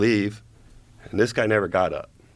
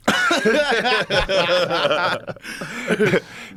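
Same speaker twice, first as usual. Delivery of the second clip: loud, high, long laugh; high burst of laughter; 12 semitones higher, 3 dB louder than usual. Real, not acted. Cracking up at his own story.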